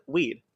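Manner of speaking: put-on Weed with W for R, as a child said Reed; imitating kid self saying brother's name Reed as Weed; child's lisped R. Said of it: "I used to say, like".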